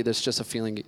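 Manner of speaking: usual speaking voice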